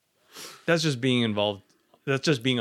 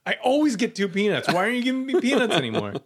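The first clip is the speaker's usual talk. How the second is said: whiny complaint; 8 semitones higher, 4 dB louder than usual. Pretend. Imagined real air marshal complaining.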